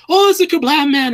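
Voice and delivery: put-on light up in childlike delight at spotting him; excited mock. Imitating the Doctor greeting the Kerblam man, gleefully excited. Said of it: "her accent was very cute there".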